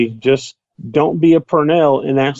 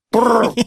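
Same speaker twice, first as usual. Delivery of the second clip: revving motor sound with lips; rolling lip trill like an engine; engine noise brrr; 9 semitones higher than usual. Pretend. Imitating a spin attack.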